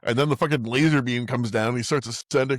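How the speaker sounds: ordinary talk, nothing marked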